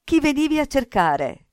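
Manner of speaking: high-pitched, insistent question; urgent questioning. A narrator's reading. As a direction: press the boy for an answer.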